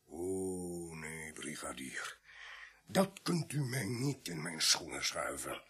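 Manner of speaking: slow, breathy, barely voiced plea; shrinking back from the accusation; frightened whispered denial. Accused man pleads with the sergeant, slow frightened whisper.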